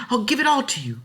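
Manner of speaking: put-on high, loud girl's voice; girl pleading. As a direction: offer everything eagerly. Ellen pleading.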